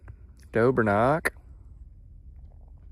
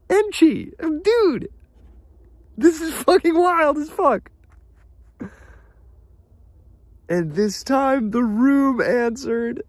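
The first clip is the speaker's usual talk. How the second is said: excited; 13 semitones higher, 3 dB louder than usual. Real, not acted. Excited reading of chat message.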